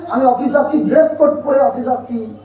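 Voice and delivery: ordinary talk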